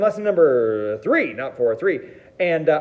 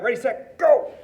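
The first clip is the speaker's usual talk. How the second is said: rising hype, go shouted high; cheerful launch into the lesson; excited countdown; 7 semitones higher than usual. Real, not acted. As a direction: count them in with a big GO. Kicking off the lesson.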